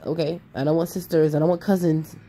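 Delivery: ordinary talk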